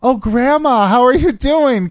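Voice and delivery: high, loud mock-solicitous greeting; addressing a grandma. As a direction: greet her like a frail old granny, loudly. Teasing friend as if a grandma.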